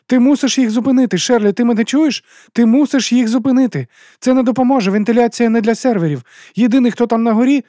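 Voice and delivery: high, loud, insistent woman; urgent Juliette. Acted. Juliette begging Sherly to stop the men, desperately urgent.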